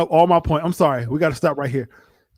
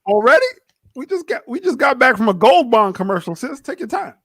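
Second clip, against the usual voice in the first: mock reply; 8 semitones higher than usual. Mocking reply to a friend.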